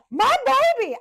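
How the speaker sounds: shrill panicked cry bursting through the doors; frantic mother's scream. Retold or imitated. Mother bursting into the hospital room, screaming for her baby. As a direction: scream for your baby at full force.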